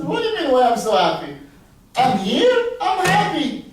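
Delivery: high, loud carefree cheer; happy beggar. Acted. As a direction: proclaim it with exuberant happiness. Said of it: "And he's singing".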